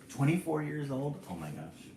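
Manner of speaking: usual speaking voice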